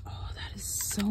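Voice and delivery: breathy hushed whisper. Real, not acted. Testing a fan, excited hushed whisper. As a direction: keep it down, neighbors are near. Keeping quiet for neighbors.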